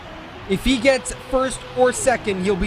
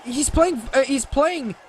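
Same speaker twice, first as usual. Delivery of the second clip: excited commentary; 7 semitones higher than usual. Real, not acted. Commentator reacts to twist finish.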